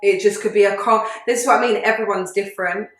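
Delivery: ordinary talk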